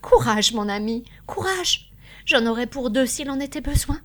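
high, emotional urging, lightly tearful; rally a loved one through tears; mildly tearful, forceful exhortation; weeping encouragement. A narrator's reading. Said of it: "y dit en pleurant".

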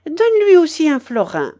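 high, loud bossy command; called-out order. A narrator's reading. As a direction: order it across the street. Grandmother calls out an order. (ordre lancé fort et haut)

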